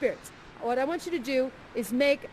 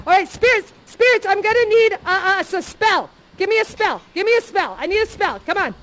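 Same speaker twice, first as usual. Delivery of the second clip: loud, higher urgent begging; frantic plea; 6 semitones higher, 8 dB louder than usual. Acted. Parent in the sword fight begging spirits for a spell, frantic. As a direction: beg for help mid-fight.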